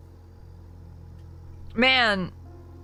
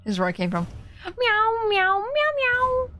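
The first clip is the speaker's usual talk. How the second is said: cat meows; 12 semitones higher than usual. Pretend. Meowing at the game.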